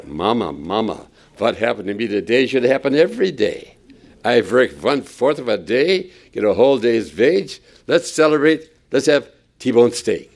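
put-on excited Yiddish-tinged accent, v for w; a gleeful worker bragging in a Yiddish accent; mock Yiddish-flavored worker. Worker bursting in telling Mama, mock Yiddish accent. Three o'clock worker to wife.

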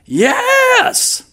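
very high shouted yes; evangelist shout. Retold or imitated. Evangelist shouting for the congregation's answer, very high. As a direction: belt it out to stir the crowd. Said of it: "the evangelist says".

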